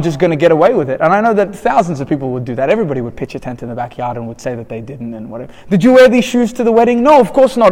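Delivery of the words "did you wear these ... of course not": higher-pitched mock innocent exchange; lightly acted questioning and protesting, pitched up; acted store exchange. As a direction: play question and denial in a raised pitch. Acted store return exchange about worn shoes.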